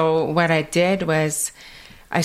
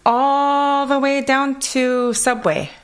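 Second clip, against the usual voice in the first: put-on higher and slow, Kwakwala-accented English; 9 semitones higher, slower than usual. Answering a friend, slow in a Kwakwala accent. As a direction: stretch it out in her Kwakwala lilt. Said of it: "I'm like".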